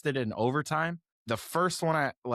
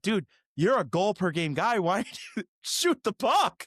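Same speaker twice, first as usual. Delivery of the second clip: incredulous yell at TV; 7 semitones higher than usual. Retold or imitated. Fan on his couch yelling at the player, exasperated laughing. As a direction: yell at the screen half laughing. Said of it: "I'm like".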